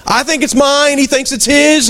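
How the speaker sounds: usual speaking voice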